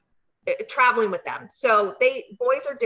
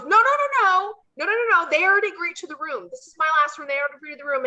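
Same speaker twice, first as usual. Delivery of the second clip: high, loud panicked clerk; flustered clerk; 7 semitones higher, 5 dB louder, faster than usual. Retold or imitated. Hotel clerk refusing Punk, high frantic voice. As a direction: shut them down in a frantic rush. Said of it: "the guy goes".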